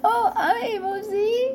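high, slow squeaky unicorn voice. Pretend. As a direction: play a cutesy unicorn. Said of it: "that was the unicorn right there".

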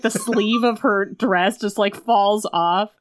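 ordinary talk

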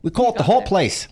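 loud, high mock Australian; laughing, hammed-up Aussie voice; Australian accent. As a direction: play a boisterous Australian local.

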